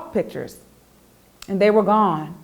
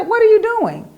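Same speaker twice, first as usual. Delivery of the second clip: eager question; 13 semitones higher, 6 dB louder than usual. Acted. Boomer approaches Roscoe and Bill.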